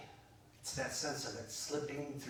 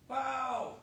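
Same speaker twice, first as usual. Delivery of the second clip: high, drawn-out wow; acted burst of triumph; amazed celebration; 11 semitones higher than usual. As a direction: exult like a brand-new champion. Celebrating a Super Bowl win.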